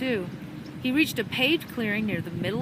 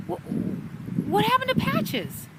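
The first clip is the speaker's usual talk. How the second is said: much higher, pressing question; urgent repeat; 13 semitones higher, slower than usual. A narrator's reading. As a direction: demand it again, pitched high.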